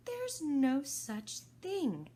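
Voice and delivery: slow, loud indignant start; girl objecting angrily; angry Alice. Acted. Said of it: "Alice was beginning very angrily".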